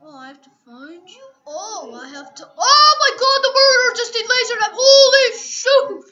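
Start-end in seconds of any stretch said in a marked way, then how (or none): 2.6-6.0 high, loud, frantic panic; panicked yelling. Real, not acted. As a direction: freak out at the murderer. Murderer attacks, panicked.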